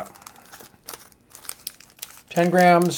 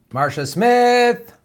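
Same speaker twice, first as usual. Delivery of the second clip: drawn out; 8 semitones higher, much slower than usual. Real, not acted. Reading out a name.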